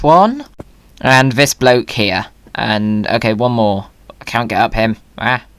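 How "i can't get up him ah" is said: normal talking voice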